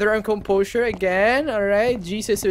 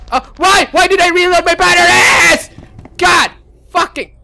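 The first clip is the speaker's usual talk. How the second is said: high, loud wail of regret; real exasperated outcry; anguished yell; 10 semitones higher, 9 dB louder than usual. Real, not acted. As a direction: wail it out in frustration. Regrets wasting batteries.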